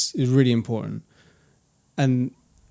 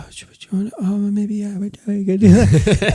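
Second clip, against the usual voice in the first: imitating his own higher, hesitant, appeasing voice; softened timid voice; 8 semitones higher, 5 dB louder than usual. Man mimicking how his voice changes, softened and hesitant. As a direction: go high and tentative, eager to agree. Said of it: "I notice it's a bit softer".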